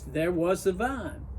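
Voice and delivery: plain reading voice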